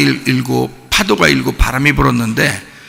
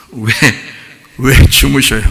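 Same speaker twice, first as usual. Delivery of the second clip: ordinary talk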